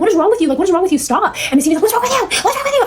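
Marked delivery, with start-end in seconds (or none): 0.0-1.4 raised pitch, exasperated retold yell; pushing back, voice up high in disbelief. [name] yelling at her attacking stepmom, high exasperated cry. 1.8-2.8 mocking high shrill echo of her words; irate. Stepmom shrieking the question back at [name], irate. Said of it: "absolutely irate".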